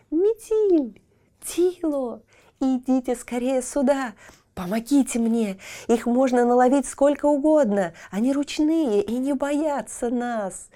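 высокий восторженный зов; ребёнок в восторге зовёт друзей; восторг. Acted. Child overjoyed in garden. (Тильтиль восторженно зовёт друзей, высоко)

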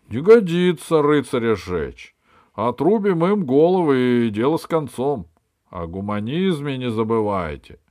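loud, high, blustering monarch; capricious king. A narrator's reading. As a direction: decree it like a spoiled king. King's voice. (капризный король)

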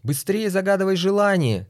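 shouting. Acted. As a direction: yell urgently from the stands. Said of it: "закричала левая трибуна".

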